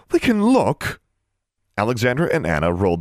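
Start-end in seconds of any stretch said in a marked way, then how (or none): 0.1-1.0 put-on high, whiny girl's complaint; complaining. A narrator's reading. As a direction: pout it out, high and put-upon. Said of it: "but Darla complained".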